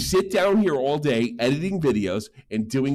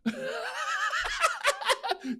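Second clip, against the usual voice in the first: high laugh; 8 dB quieter than usual. Real, not acted. Laugh after the rant.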